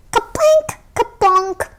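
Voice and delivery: high ringing plinks; plinking sounds. Acted. Berries dropping into Sal's pail, high plinks.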